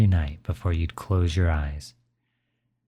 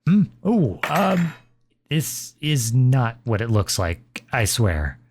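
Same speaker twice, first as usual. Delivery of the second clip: flustered, startled pitch jump then slower denial; caught out; 4 semitones higher, slower than usual. Acted. Father caught sneaking ice cream by his kid, flustered. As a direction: sputter like you've been caught red-handed.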